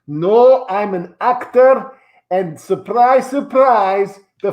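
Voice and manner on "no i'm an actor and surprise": ordinary talk, nothing marked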